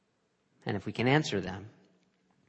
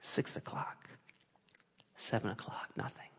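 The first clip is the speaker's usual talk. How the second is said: breathless whispered count; barely voiced, hours slipping by; 13 dB quieter than usual. Preacher reliving a lonely night, whispered.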